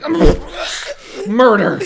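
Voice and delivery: laughing confession. Retold or imitated. Imagining answering a cop at a blockade, laughing. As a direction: blurt it out and crack up. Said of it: "And I'll be like".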